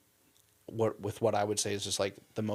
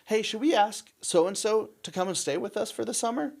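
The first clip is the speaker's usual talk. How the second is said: higher, louder call across the house; retold call to wife; 12 semitones higher, 6 dB louder than usual. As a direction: shout the question to the next room. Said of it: "he yells to his wife".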